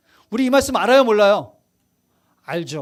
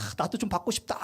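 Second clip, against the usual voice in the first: wistful aside; 7 semitones lower, 12 dB quieter than usual. Retold or imitated. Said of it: "방언이 막 나오니까".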